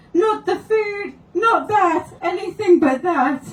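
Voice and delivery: high, loud mock sobbing beg; hammed-up wailing plea. Client pretending to dread the food forfeit, in a hammed-up wail. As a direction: whine like a doomed game-show loser, fake-crying. Client hams up a game-show loss.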